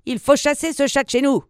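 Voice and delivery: put-on high, scolding old-woman voice; mock angry cry without real volume. A narrator's reading. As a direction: complain crossly in a high put-on voice. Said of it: "cria-t-elle dans les oreilles du vieux".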